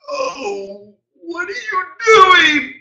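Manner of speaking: high, slow wailing protest; anguished cry. Retold or imitated. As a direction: wail as if watching money spill. Friend dismayed at spilled champagne.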